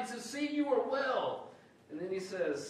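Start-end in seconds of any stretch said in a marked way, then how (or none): 0.2-1.5 high and drawn out with delight; slow, beaming exclamation; excited. Shown as a demonstration. Preacher showing Jesus excited, high and slow. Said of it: "It means he's excited".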